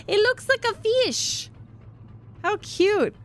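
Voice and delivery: squealing high over something adorable; cutesy gush. Real, not acted. Streamer gushing over the sailor's ribbon, high and cutesy. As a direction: coo like you just saw a kitten.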